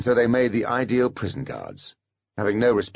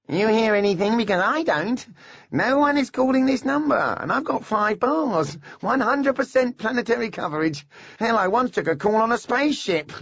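Pip voice; 3 dB louder than usual. A narrator's reading. TV host Pip taunts on camera.

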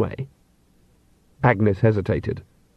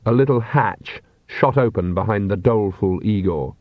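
ordinary delivery

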